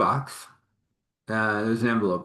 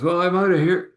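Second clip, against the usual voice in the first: cheerful exit; 9 semitones higher, 6 dB louder than usual. Retold or imitated. Her cheerful exit line.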